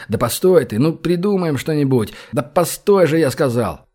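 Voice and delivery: plain reading voice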